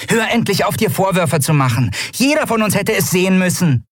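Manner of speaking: high-pitched scolding voice; reproving Nelen. A narrator's reading. Nelen to Endriel about self-blame, high reproving voice. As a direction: tell them off in a high voice. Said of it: "die Arme tadelnd verschränkt".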